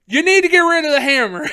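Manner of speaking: imitated police order; mock insistence. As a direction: insist firmly like an officer. Retold cop insists.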